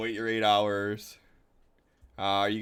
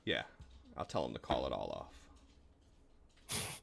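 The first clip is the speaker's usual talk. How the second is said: chuckling aside; 13 semitones lower, 15 dB quieter than usual. Acted. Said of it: "he just, like, chuckles and he's like".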